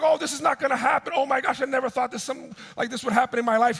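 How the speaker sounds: high, racing mock panic; hammed-up agitated rush of words; nervous and angry. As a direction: imitate a rattled man ranting fast. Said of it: "He's nervous, he's angry".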